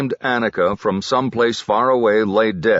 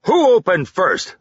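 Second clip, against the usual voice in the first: loud, high demand; sergeant yelling; 10 semitones higher, 4 dB louder than usual. A narrator's reading. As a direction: yell it at the troops. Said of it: "the sergeant yelled".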